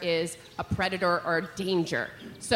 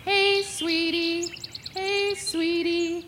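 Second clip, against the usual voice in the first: high, slow whistled "Hey, sweetie"; chickadee song; 8 semitones higher, much slower than usual. Shown as a demonstration. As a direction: sing it like a male chickadee courting. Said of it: "he sings".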